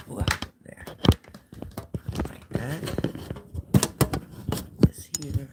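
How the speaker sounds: unvoiced aside while fixing the camera; whisper. Real, not acted. Host whispering to herself while setting up the stream. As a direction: breathe it under your breath.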